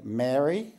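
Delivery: "Mary" with the long vowel of mare, rising; East Coast pronunciation. Shown as a demonstration. East Coast speaker showing the girl's name, distinct long-vowelled "Mary". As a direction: say "Mary" like mare-ee, lifted as a list item. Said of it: "in the East Coast, we say".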